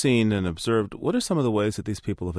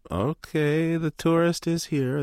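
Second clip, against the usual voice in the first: higher, slow mock voice of a jaded local; mock bushman voice; 6 semitones higher, 3 dB louder than usual. Host imagining the locals' reaction, higher mock voice. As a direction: drawl it out like someone seeing tourists coming. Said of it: "kind of like".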